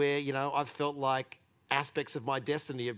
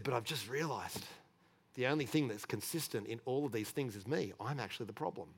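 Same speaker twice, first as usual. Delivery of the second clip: quiet, softer, lower, words coming out trembling; shaken confession; 3 semitones lower, 7 dB quieter than usual. Retold or imitated. Big workmate confessing to the speaker, quietly shaken. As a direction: let the voice sink as he admits it to himself. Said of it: "He was physically shaking as he was saying this".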